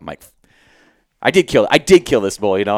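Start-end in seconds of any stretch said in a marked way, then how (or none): 1.2-2.6 louder, higher, with stress on "did"; "did" hit hard the second time; psyching himself up. Retold or imitated. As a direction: insist on "did" to convince yourself. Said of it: "trying to, like, psych myself up".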